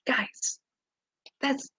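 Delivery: soft whisper to the chat. Real, not acted. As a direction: whisper it, overwhelmed. Awed address to viewers.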